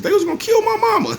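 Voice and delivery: squeaky high mock of a lame excuse; mocking whiny excuse. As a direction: mimic a snitch pleading his excuse.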